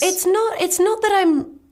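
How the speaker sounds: light, high cartoon voice; a hint of a high cartoon princess. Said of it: "I do sometimes just sound like a cartoon character".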